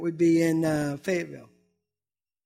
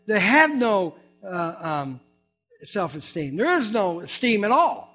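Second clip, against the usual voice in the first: louder, slower revival-style preaching; 3 semitones higher, 7 dB louder than usual. Acted. Pastor exhorting his congregation to reach out, preaching. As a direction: lean into it like a preacher calling the flock.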